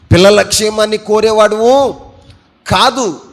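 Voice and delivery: loud, high emphatic declaration; fervent shout from the pulpit; loud preaching. Real, not acted. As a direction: thunder the rebuke at the congregation. Preaching outburst.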